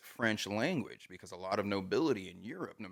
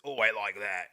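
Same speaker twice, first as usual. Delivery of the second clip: rough British; 7 dB louder than usual. Shown as a demonstration. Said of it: "like, rough".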